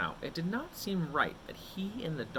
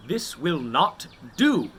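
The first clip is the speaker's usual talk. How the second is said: loud, slow, firm; slow and loud, drawing the line; firm declaration; 3 semitones higher, 12 dB louder, slower than usual. Acted. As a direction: plant your feet and declare it. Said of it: "he declared".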